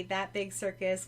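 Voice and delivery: normal talking voice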